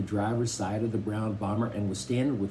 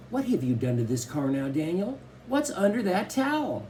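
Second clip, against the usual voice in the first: worried; 7 semitones higher than usual. A narrator's reading. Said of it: "Mama had a worried note".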